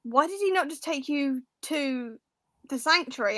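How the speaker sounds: ordinary delivery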